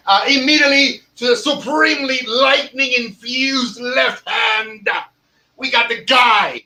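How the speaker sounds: high, booming ring-announcer call; announcer intro. Acted. Host introducing his co-host to the audience, booming announcer call. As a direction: shout it like introducing a champion.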